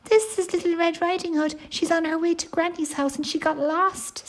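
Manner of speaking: put-on high-pitched baby bear; small, high cub voice; baby-sized voice. Baby bear introduces the lost girl, high baby-sized voice. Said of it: "said Baby Bear in a baby-sized voice".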